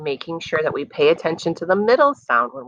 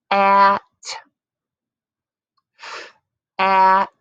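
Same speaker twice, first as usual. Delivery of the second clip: slow loud blending sound; stretched louder sound; 4 semitones higher, 4 dB louder than usual. Shown as a demonstration. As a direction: hold the word out, loud and slow. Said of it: "say some words like a turtle".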